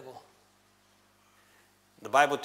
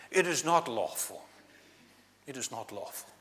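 mock posh accent; 6 dB quieter than usual. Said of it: "putting on that posh accent".

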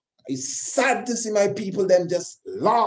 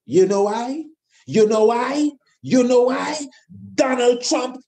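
building passion; 6 semitones higher, 4 dB louder than usual. Real, not acted. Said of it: "I got to say it with passion".

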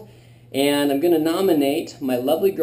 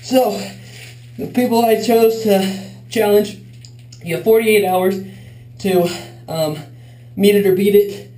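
in ice cold water; 6 semitones higher than usual. Real, not acted. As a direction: push words out through freezing water. Lying in ice bath.

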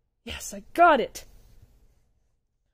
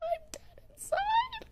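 strained high wail; 9 dB quieter, slower than usual. Acted. Defeated cry.

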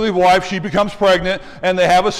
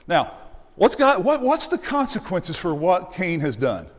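ordinary talk, nothing marked

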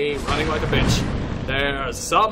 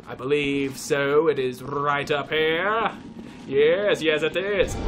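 usual speaking voice

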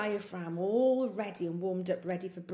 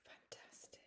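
whisper; 28 dB quieter than usual. Shown as a demonstration. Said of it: "whispered praise".

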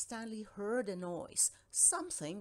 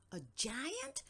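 surprised cry; 5 semitones higher than usual. A narrator's reading. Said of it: "he cried".

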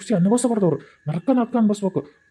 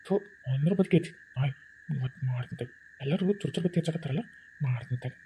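much softer, lower, plotting under the breath; scheming mutter; 7 semitones lower, 11 dB quieter than usual. Acted. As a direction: hiss out the threat to yourself. Villain plots revenge alone.

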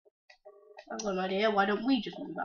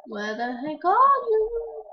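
exasperated call; 10 semitones higher, 7 dB louder, slower than usual. Acted. Searching for crush.

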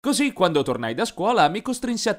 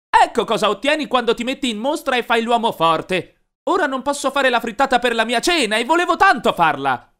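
higher, louder furious scolding; furious yell; 9 semitones higher, 4 dB louder than usual. A narrator's reading. Mom yells at Glenn over the broken eggs, furious. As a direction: mom tears into him, loud and high. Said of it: "La mamma era furiosa e urlò".

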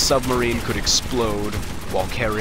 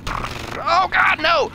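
much higher, hammed-up cry of terror; mock panic scream; 4 dB louder, much slower than usual. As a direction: shriek it like you just realised you're doomed. Panics at the gun turret.

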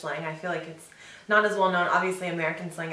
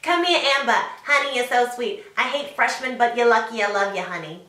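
put-on loud, higher old New York smoker voice; Long Island teacher; 3 semitones higher, 4 dB louder than usual. Teacher fussing over a favourite freshman, Long Island accent. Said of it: "imitating one of my high school teachers".

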